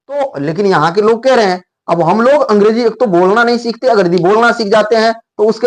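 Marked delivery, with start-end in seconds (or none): none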